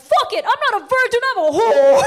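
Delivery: high, loud outburst into the phone; shouting breakdown. Retold or imitated. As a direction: yell it out in public. Yelling on phone in Times Square.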